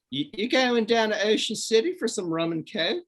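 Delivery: high-pitched Baltimore accent demo. Shown as a demonstration. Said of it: "that's a Baltimore accent".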